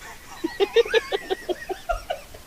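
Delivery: rising squeal of laughter; high-pitched laugh. Real, not acted.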